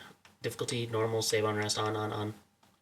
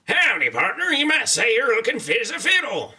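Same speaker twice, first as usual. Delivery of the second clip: higher, folksy cowboy greeting; cowboy character read; 8 semitones higher than usual. Acted. Streamer voicing a game character whose audio is broken, cowboy voice. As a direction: greet like a friendly old-west cowboy. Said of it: "now I am reading this".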